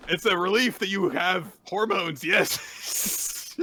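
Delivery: high, loud mock rejoicing; delighted relief. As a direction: exclaim it in happy relief. [name]'s acted delight continues.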